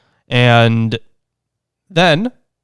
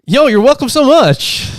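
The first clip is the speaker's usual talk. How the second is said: mock high voice; 12 semitones higher than usual.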